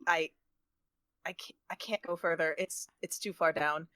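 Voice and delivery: soft, low, halting admission; defeated message. Acted. Baku messaging Selwyn from down the pit, quiet and defeated. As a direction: give up quietly, voice dropped. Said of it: "message Selwyn and be like".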